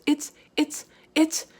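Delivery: high, stumbling excited starts; excited stammer. Acted. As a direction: trip over the words in excitement.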